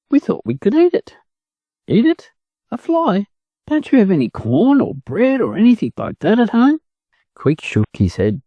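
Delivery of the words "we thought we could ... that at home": astonished. Acted. Chicken astonished at ducklings.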